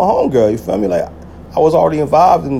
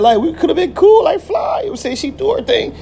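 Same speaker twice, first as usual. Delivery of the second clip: higher, giggling through it; laughing. Real, not acted. Laughing while picturing being friends with her. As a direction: let the laugh break the words.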